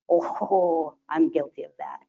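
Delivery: usual speaking voice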